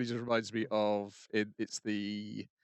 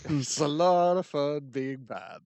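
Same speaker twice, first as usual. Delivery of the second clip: slow, gloating little rascal voice; gleeful bad kid; 4 semitones higher, 4 dB louder, slower than usual. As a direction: savour every word like a proud brat. Relishing the naughty kid's line.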